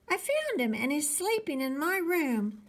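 put-on high-pitched kid arguing back; insistent little boy. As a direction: insist on it like a small child.

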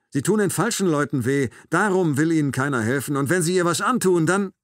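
loud, high, urgent argument; heated warning. A narrator's reading. Narrator warns the man threatening the child, heated and urgent. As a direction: press the warning with rising force.

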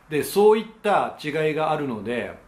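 ordinary delivery

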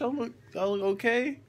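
ordinary talk

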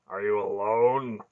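slow, loud questioning with suspicion; suspicious. A narrator's reading. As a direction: draw the question out, wary and forceful. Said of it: "Beric's voice was heavy with suspicion".

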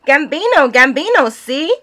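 high, loud call to the bird; yelling to canary. A narrator's reading. Missus Andesola showing the painting to her canary, high yell. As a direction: yell the canary's name eagerly. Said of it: "Missus Andesola yells".

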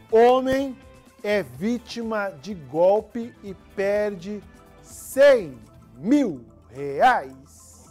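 loud, slow dramatic headline. Acted. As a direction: boom the headline out.

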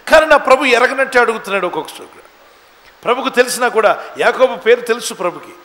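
loud, driving sermon delivery; forceful preaching. Real, not acted. Preacher telling congregation what the world seeks, forceful and loud. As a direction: preach it out hard, rising on key lines.

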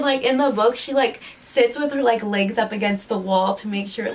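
ordinary talk

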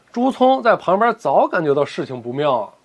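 ordinary delivery

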